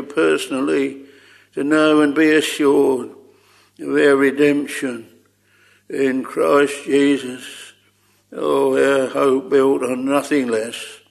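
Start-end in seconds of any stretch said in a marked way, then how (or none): none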